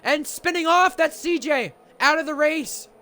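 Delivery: excited live race call; motorsport commentator. Real, not acted. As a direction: call the crash with rising energy.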